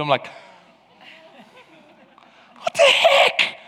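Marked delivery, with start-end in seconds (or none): 2.6-3.6 high, startled what-the-heck; shocked. Retold or imitated. Preacher retelling his shock at the stranger. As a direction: blurt it out in disbelief.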